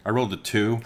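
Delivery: ordinary delivery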